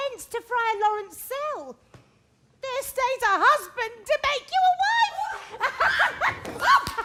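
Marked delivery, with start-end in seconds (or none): 2.6-6.9 high, slow giggling tease; laughing. Acted. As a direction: cackle and shoo her off. Nurse teasing bride-to-be.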